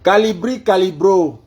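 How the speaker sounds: name called high and loud; calling out. Real, not acted. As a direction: project each syllable clearly. Retrying the name for the assistant.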